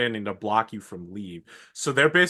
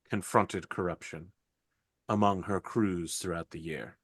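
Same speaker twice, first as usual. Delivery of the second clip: solemn sister voice; 3 semitones lower, 3 dB quieter than usual. Acted. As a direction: speak low and grave like a holy sister. Sister speaks in the holy place.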